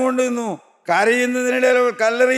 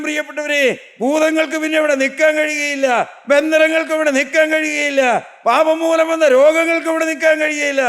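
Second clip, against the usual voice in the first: rising preaching climax; 6 semitones higher than usual. Acted. Preacher rallying the believers against demons, building to a shout. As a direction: drive it home to the congregation, climbing.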